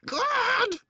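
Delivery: very high, loud crone's screech; old hag screaming. Acted. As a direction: screech it like an angry witch. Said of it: "she screamed".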